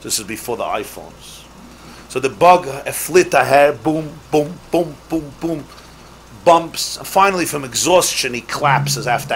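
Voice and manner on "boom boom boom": ordinary talk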